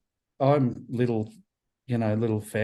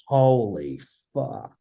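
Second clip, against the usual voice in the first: slow, drawn-out awed exclamation; retold awe; much slower than usual. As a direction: stretch it out in stunned amazement. Retelling his shock at the stage.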